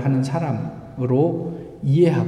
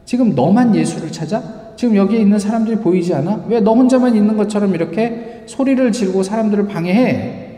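louder, higher reproachful scolding; a crowd rebuking a noisy blind man, indignant; 6 semitones higher, 4 dB louder than usual. Retold or imitated. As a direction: snap at the beggar for making a scene. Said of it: "많은 사람들이 그에게 비난합니다".

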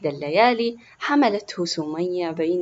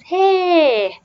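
high, loud, drawn-out cheer; excited; 10 semitones higher, 9 dB louder, much slower than usual. A narrator's reading. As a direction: cheer like a delighted little boy. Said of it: "قال يوسف بحماس". (هتاف حماسي طفولي)

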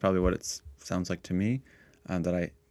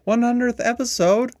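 high, loud, amazed question; surprised; 10 dB louder than usual. Real, not acted. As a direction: gasp out the number in disbelief. Amazed by hundredth episode milestone.

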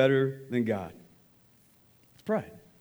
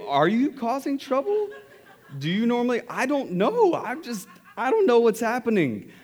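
laughing disbelief; 7 semitones higher than usual. Retold or imitated. Awkward exchange, laughing, high.

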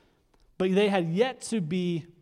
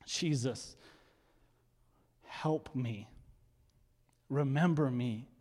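soft, lower plea of someone lost; quiet plea; 3 semitones lower, 6 dB quieter, slower than usual. Acted. Preacher voicing a lost person calling on Jesus, quiet plea. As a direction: voice a hushed, acted prayer for help. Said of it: "in a time of need for someone that's far from God".

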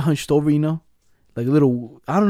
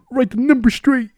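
mock high voice; 10 semitones higher, 4 dB louder than usual. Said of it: "someone's like".

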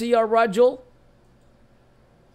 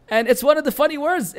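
ordinary talk, nothing marked

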